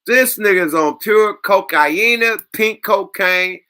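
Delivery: loud, high, incredulous yell; excited shout. Real, not acted. Reacting to the clip, yelling in disbelief.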